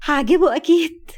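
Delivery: giggle, then a high, loud, drawn-out line; laugh, then say it with delighted confidence. A narrator's reading. Wife admires herself in the mirror, laughing confidently. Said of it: "وقالت وهي بتضحك".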